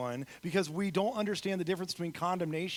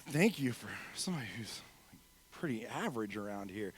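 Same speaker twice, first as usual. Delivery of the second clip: hushed, near-whispered low aside; mock deadpan reaction, barely voiced; muttered aside; 7 semitones lower, 6 dB quieter than usual. Preacher voices an unimpressed New Yorker in a joke, muttered aside.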